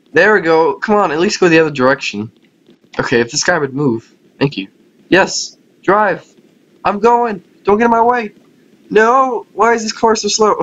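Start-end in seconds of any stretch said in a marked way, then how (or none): 5.1-10.6 high, frustrated exclamations; frantic calling out. Real, not acted. Player urges a slow car past others, frantic calling out. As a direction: cry out high at the cars blocking you. Yelling at cars in the game.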